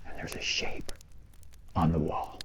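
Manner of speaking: low spooky whisper for the build-up; hushed storytelling. A narrator's reading. Storyteller whispering the scary part to campers. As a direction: draw them in with a hush.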